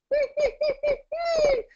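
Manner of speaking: very high falling little whine; puppy whine. Shown as a demonstration. Voice teacher demonstrating a puppy whine for sopranos. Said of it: "whine like a little puppy".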